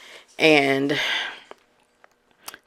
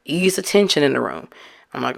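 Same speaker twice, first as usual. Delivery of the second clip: ordinary talk, nothing marked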